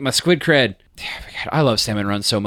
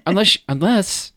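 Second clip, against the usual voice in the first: high, slow, earnest animal voice; high mock dog voice; 8 semitones higher than usual. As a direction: play the dog lecturing Jerry. Voicing the wild dog, high.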